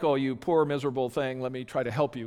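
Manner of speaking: a hint of patronising sympathy; mock condescending pity. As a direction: coo over them like a poor miserable thing. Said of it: "pity's like".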